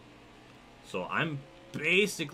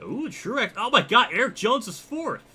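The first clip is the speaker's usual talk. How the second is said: high-pitched, louder burst of amazement; real astonishment jumping up in pitch; amazed surprise; 12 semitones higher, 7 dB louder than usual. Real, not acted. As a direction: gasp out the surprise, high and loud. Surprised by qualifying order.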